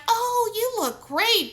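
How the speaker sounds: high, slow fake-sweet voice; sugary mock compliment. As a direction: drag it out, mimicking the old lady. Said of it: "was like".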